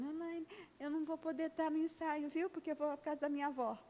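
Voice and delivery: put-on a small girl telling her dance leader she cannot come; polite little girl. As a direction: play the child excusing herself. Child phones leader.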